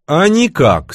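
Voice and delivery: lightly disgruntled; wry displeasure. A narrator's reading. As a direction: answer with a touch of distaste. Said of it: "скривился Левша".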